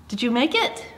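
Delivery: usual speaking voice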